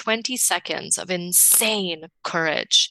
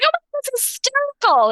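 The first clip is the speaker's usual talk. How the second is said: much higher, giggling at nothing; giggly self-quote. Retold or imitated. Teller quoting herself laughing on the subway, high and giggly. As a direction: laugh it off like everything's hysterical.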